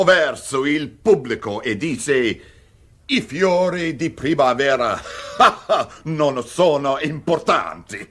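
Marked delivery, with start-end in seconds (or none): 3.1-8.1 giggles breaking into the quote; can barely get the line out for laughing; laughing through the punchline. Acted. Cortese retelling the conductor's line to the guests, laughing.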